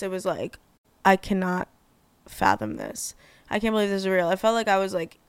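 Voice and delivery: normal talking voice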